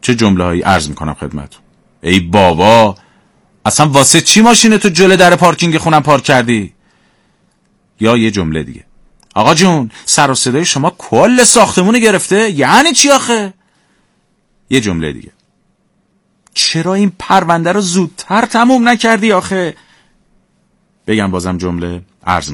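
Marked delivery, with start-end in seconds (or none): 2.0-6.7 irritated neighbour, rising higher; mock complaint about a parked car; mildly angry example. Shown as a demonstration. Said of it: "با عصبانیت گفته". 9.4-13.5 angry complaint. Shown as a demonstration. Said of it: "با عصبانیت گفته". 16.5-19.8 higher reproachful scolding; irritated boss. Shown as a demonstration. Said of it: "با عصبانیت گفته".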